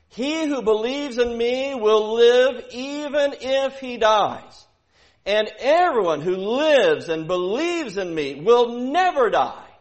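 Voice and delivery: loud, high and slow, every word driven home; full-force preaching. Acted. Preacher proclaiming Jesus' words to congregation, booming and slow. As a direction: proclaim it like thunder from the pulpit.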